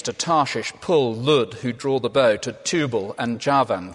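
measured, solemn Bible reading; scripture reading. Acted. Preacher reads Isaiah to the congregation, solemn and measured.

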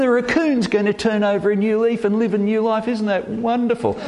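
high, quick sentimental gushing; hammed-up tender wonder, pitched high; mock moved. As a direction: play being touched to the point of tears. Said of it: "feel the emergence of a tear".

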